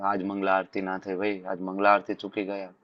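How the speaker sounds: low, subdued self-reproach; mild regret. Acted. Devotee regrets missing morning prayer, mildly downcast and regretful. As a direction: softly lament to yourself, lightly acted. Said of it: "ગુસ્સો આવે".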